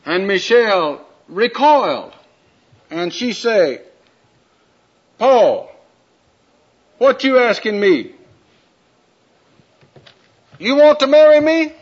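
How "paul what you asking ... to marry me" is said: put-on high, loud, incredulous young woman; Michelle's voice. Michelle scoffing at Paul's proposal, high and loud. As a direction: throw the question back at him, high and loud.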